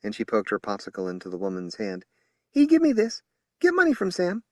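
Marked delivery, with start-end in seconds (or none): 2.5-4.4 put-on high child voice with a country twang; little girl. A narrator's reading. Little Ava explaining the popsicle, childlike. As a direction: say it like a small hill child.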